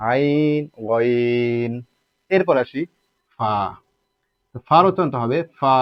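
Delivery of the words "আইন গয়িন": আইন, গয়িন with the glides held; long level vowels in আইন, গয়িন; elongated glide. Shown as a demonstration. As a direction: stretch আইন and গয়িন evenly. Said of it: "এক আলিফ পরিমাণ করে টানতে হবে".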